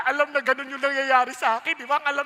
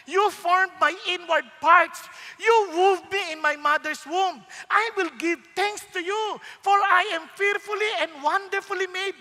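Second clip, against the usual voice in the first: slow, louder, solemn reading; scripture reading; 5 semitones higher, 5 dB louder than usual. Acted. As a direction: proclaim the psalm with reverence. Reads psalm.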